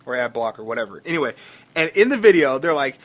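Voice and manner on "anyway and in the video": ordinary delivery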